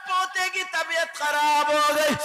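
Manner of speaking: loud rhythmic preaching over music; fervent sermon. Acted. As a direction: preach it out to the crowd. Preaching at full force, music. (بلند آواز میں پرجوش خطابت)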